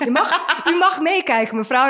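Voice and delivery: laughing through a fake doctor line; mock doctor voice. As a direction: play a cheerful doctor, half laughing. Mock doctor during a camera exam.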